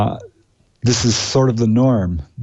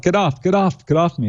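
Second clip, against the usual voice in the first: imitated scream; 6 semitones higher than usual. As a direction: imitate a screamed protest at conversational volume. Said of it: "It started screaming out".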